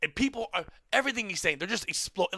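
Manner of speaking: ordinary delivery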